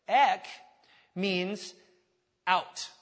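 "Ek" punched loud, then "means" … "out" with pauses; emphatic definition. Shown as a demonstration. Teaching the congregation the Greek roots, loud, spaced words. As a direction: give "Ek" a loud strike and pause before "out". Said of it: "ek and kaleo".

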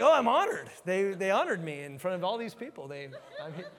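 hammed-up honored gush; mock flattered. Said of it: "but I'm like".

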